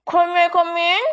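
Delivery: high loud call through the door; maid calling. A narrator's reading. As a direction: sing out from behind the door. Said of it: "she asked".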